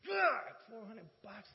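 loud, drawn-out exclamation of disbelief; mock shock. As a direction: reel at the sum, slowly. Acted shock at the amount.

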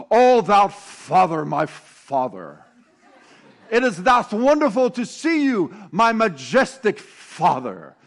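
hammed-up King James address, loud and stately; mock formal speech. A daughter greets her father in a pompous, booming mock-formal voice. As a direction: play a child putting on a grand church voice. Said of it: "Olivia comes in and goes".

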